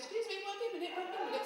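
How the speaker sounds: high, fluttery excuse to leave; fussy old student. Retold or imitated. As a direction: squeak it out like a flustered pensioner. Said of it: "Somebody else going".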